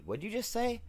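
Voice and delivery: high, incredulous squeak of disbelief; brother's surprise. Retold or imitated. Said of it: "he goes".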